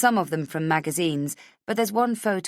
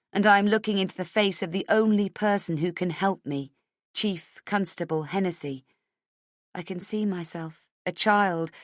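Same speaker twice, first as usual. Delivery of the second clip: ordinary delivery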